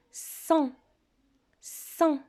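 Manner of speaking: "Cent" said slowly and clearly, twice, rising pitch; dictation voice. Shown as a demonstration. Teacher dictates "Cent" to pupils, clear and deliberate. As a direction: dictate "Cent" carefully for the children to write. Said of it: "Écoutez une autre fois".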